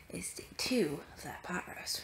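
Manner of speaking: soft fast whisper. Real, not acted. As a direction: hush it, people are sleeping. Late night whisper sign-off.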